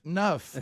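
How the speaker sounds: Nuff said as fun reversed; backwards speech. Pretend. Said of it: "say it backwards".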